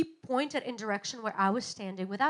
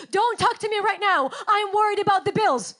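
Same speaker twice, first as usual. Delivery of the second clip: loud, higher irritated brush-off; irritable snap; 7 semitones higher, 9 dB louder than usual. Pretend. Worried parent snaps at her child, loud and irritated. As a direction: snap at the child to be left alone.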